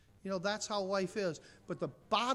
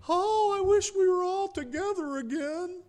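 high, loud hammed-up wistful wail; mock pining; 11 semitones higher, 7 dB louder than usual. Preacher mocking the idea of Lazarus missing family, high pining wail. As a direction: whine it with exaggerated longing. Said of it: "But he wasn't pining".

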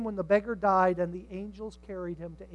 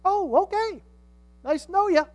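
high, loud, mock-polite exit line; hasty brush-off; 11 semitones higher, 9 dB louder than usual. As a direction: act out dodging the subject. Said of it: "how fast they change the subject".

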